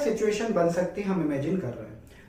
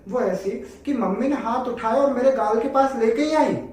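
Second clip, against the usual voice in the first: excited build-up; 5 semitones higher, 3 dB louder than usual. Acted. Teacher builds up to fifth case.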